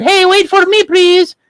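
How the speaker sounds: very high pleading fairy. Acted. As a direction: squeal after them as a tiny fairy. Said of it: "And the fairy said".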